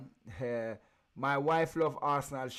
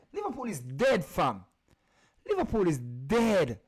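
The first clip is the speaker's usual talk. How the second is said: mocking declaration; 5 semitones higher, 6 dB louder than usual. Mocking Liverpool fans loudly.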